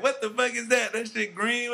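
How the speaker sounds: high, loud disgusted outburst; disgusted surprise. Retold or imitated. At a work function, recoiling from green ambrosia salad, high and loud. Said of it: "I be like".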